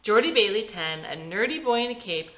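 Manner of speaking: usual speaking voice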